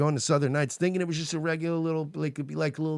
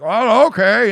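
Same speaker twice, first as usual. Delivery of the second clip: mock drag queen; 10 semitones higher, 12 dB louder, slower than usual. Drag queen brushing off answer.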